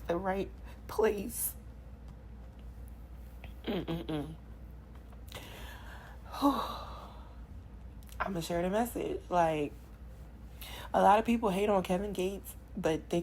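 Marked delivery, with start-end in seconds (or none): 5.2-8.1 emotional sigh. Real, not acted. Overwhelmed, emotional after the message.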